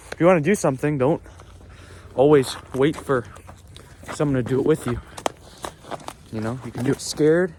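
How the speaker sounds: ordinary talk, nothing marked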